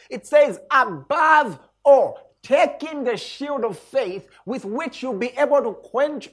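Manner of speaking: "Above all" slow, high and loud, then drawn on; emphatic scripture. Shown as a demonstration. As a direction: preach it with full weight. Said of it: "special emphasis on this".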